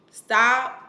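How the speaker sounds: loud rising command; loud stop. Real, not acted. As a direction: call it out sharply. Scolding parents.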